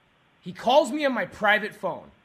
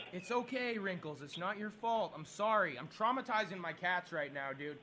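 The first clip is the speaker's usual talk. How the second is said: low-volume, gentle comforting of a pet; soothing apology; 3 semitones lower, 10 dB quieter than usual. Real, not acted. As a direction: drop right down softly to calm the cat. Soothing the frightened cat afterwards.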